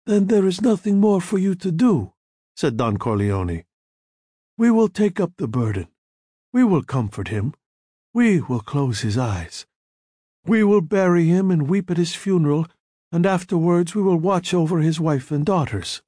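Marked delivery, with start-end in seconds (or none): 0.1-2.1 a trace of Italian in the vowels; slight Italian accent. A narrator's reading. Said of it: "trace of an Italian accent". 4.6-16.0 a trace of Italian in the vowels; slight Italian accent. A narrator's reading. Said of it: "trace of an Italian accent".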